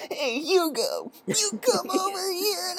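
very high, slow strained call; a bully squeaking out his last breath; breathless bully voice. Retold or imitated. Breathless bully calls Hugo.

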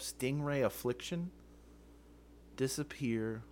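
usual speaking voice

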